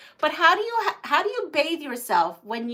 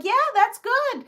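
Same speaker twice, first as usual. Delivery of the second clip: cheering approval; 12 semitones higher than usual. Retold or imitated. Cheering the old lady on.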